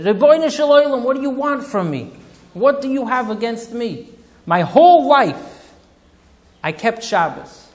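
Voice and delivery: high, loud pleading wail; anguished cry to God. Retold or imitated. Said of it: "he says, screaming to the Eibishter".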